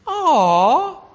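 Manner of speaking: high, loud drawn-out aww; long tender aww, hammed-up; sympathetic aw. Shown as a demonstration. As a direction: coo at a crying baby. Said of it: "All you adults knew that one too".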